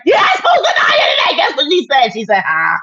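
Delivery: high, shouted, laughing mock gossip. Mocking the gossiping trolls, shrill and laughing. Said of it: "the ones that go back and be like".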